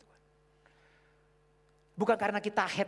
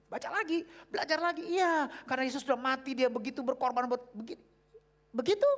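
urging; 9 semitones higher, 5 dB louder than usual. Retold or imitated. Acted urging to read again.

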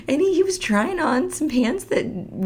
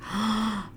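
gasp; 6 dB quieter than usual. Retold or imitated. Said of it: "I'm like".

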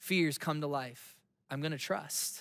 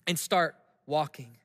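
usual speaking voice

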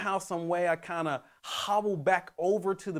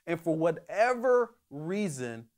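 usual speaking voice

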